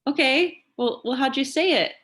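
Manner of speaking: high, loud questioning dad voice; dad playing along. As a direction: imitate a parent humoring an excited child. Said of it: "And Dad's like".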